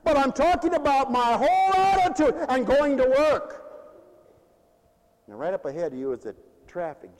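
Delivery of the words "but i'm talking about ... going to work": loud, high exhortation; fired-up pulpit shout; impassioned preaching. Real, not acted. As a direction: drive the point at full voice. Preacher returns to the point.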